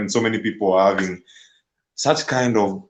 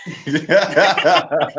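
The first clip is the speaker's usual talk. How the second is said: amused laugh; 6 semitones higher than usual. Real, not acted. Guest laughs at teasing question.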